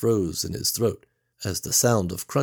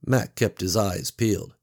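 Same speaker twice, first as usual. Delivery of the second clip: usual speaking voice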